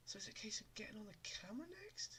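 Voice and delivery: fast whisper to himself. Real, not acted. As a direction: whisper the question quickly.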